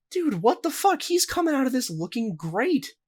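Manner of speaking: can't believe what you're seeing; shocked disbelief. Retold or imitated. Retelling own shocked reaction.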